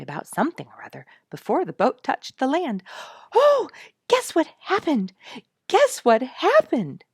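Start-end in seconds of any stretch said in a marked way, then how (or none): 3.3-7.0 high, loud and eager, bursting with news; a child crying out her big news; squealing with excitement; excited cry. A narrator's reading. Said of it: "she cried".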